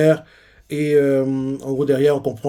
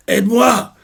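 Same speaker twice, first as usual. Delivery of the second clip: desperate plea; 8 semitones higher, 5 dB louder, slower than usual. Retold or imitated. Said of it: "Rox lui avait fait".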